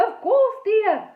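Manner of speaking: high, loud sweet indulgence; smiling. A narrator's reading. Mother smiling as she gives in to daughter. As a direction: indulge the child with a smile. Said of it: "the sunglasses woman smiled".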